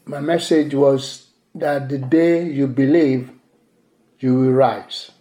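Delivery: ordinary talk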